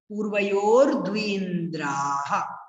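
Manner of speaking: slow, drawn-out पूर्वयोर द्विन्द्राः; careful proper recitation. Shown as a demonstration. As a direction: lengthen the vowels and echo the final ह of द्विन्द्राः. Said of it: "ऐसे बोलेंगे". (पूर्वयोर द्विन्द्राः धीरे-धीरे, साफ़ विसर्ग के साथ)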